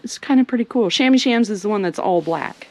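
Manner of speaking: ordinary talk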